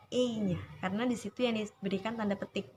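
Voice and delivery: ordinary talk, nothing marked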